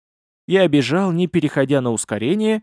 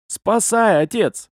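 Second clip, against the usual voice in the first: high plea falling away; imploring call for help; pleading; 7 semitones higher, slower than usual. A narrator's reading. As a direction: beg an old man for rescue. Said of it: "когда взмолился".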